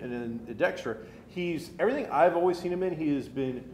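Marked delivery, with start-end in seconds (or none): none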